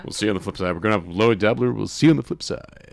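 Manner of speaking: hammed-up radio DJ sign-off. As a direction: play a cheesy radio DJ. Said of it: "Is that what, like, D Js say?".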